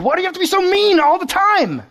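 high, loud mock complaint; exasperated. Pastor imitating telling off mean people, high and loud. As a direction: whine it high at someone who's always mean.

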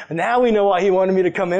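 ordinary talk, nothing marked

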